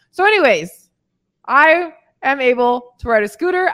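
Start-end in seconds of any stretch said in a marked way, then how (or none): none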